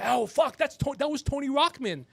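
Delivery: mock shocked bystander; startled recognition. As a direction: blurt it out like you just spotted him. Imagined witness recognizing him.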